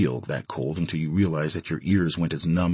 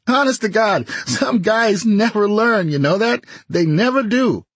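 laughing; 14 semitones higher, 5 dB louder than usual. Acted. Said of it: "Ray said, laughing".

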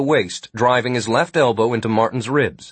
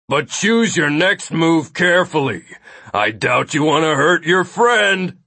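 raised character voice, taunting threat; menacing Nilo; 5 semitones higher than usual. A narrator's reading. Nilo holding Martin by the throat, menacing warning. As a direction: warn them off in a higher, projected voice.

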